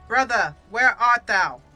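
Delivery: loud, drawn-out theatrical call; mock-dramatic, booming summons. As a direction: call out slowly and grandly for a lost brother. Hammed-up call for missing brother.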